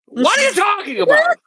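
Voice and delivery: loud, high disbelieving shout; exasperated outburst. Real, not acted. Fed up with the movie's lyrics, shouting in disbelief. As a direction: throw up your hands at the nonsense, loudly. Ranting about the movie's nonsense.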